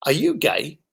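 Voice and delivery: measured, point-blank question; changed tone. Retold or imitated. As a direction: ask it plainly after a weighty pause. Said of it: "my tone had changed".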